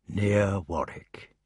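slow, soft, dreamy repeat; the old king musing aloud, slowly; slow wondering. Acted. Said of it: "seemed to be trying to imagine the place".

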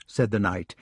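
plain reading voice

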